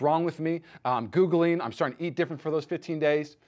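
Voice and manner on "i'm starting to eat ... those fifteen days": normal talking voice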